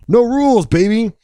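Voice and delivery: high, punchy swagger; swaggering catchphrase. Pretend. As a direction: toss it off like a rebel. Catchphrase repeated, much higher.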